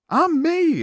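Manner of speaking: hammed-up squeaky grandpa; grumpy grandpa. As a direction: play a cranky grandpa demanding food. Grandpa insists.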